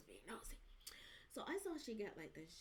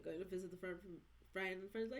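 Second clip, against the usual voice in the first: ordinary talk, nothing marked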